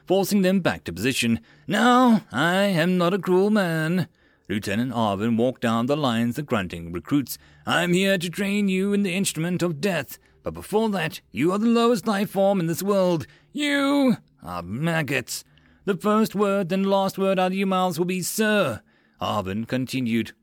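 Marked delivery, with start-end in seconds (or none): 1.7-4.1 loud high opening, then slow and high; slow, high-pitched lieutenant speech; drill officer. A narrator's reading. Officer lectures recruits. 7.7-18.8 high-pitched drill instructor tirade; haranguing lieutenant voice; drill officer. A narrator's reading. Drill officer berates recruits.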